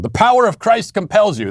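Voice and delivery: high, declaiming exorcism chant; hammed-up exorcist commanding a demon; mock exorcist. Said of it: "this is like an exorcism".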